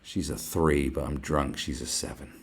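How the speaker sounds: put-on low, soft leering drunk; lightly voiced drunk leer; mock drunk lad. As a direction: mumble like a drunk lad ogling. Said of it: "looking at her going".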